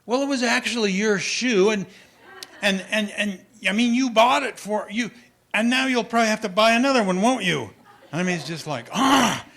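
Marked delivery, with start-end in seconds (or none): 0.1-7.7 put-on higher, louder, fast indignant boy; kid mad back. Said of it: "he gets a little mad back at me". 8.9-9.4 stunned gasp. Retold or imitated. Father's stunned reaction.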